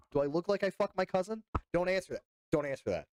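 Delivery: high, teasingly offended; mock indignation. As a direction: challenge them in put-on outrage. Mock offence at chat's Alabama guess.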